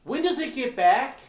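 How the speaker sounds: high-pitched clueless tourist voice; dumb tourist. Acted. Tourist asking train worker about schedule, high clueless voice. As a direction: ask it high and naive. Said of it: "my dumb tourist".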